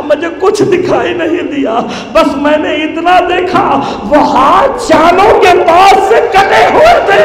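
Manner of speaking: rising wail. Acted. Boy tells of the severed hands, rising to a piercing wail.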